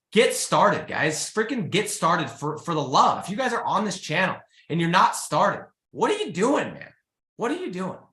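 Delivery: exasperated pep talk, voice climbing high; a little excited. Real, not acted. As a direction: get carried away urging them on. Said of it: "Sorry, getting a little excited there".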